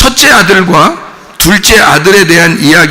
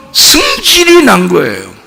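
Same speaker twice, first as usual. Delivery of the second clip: 승질 stretched long and pitched high; exploding emphasis; 8 semitones higher, slower than usual. Shown as a demonstration. Preacher stresses how angry the brother was, exploding on 승질. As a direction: burst out on 승질, then fall away. Acting out the brother's eruption of anger.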